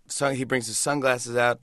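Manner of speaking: normal talking voice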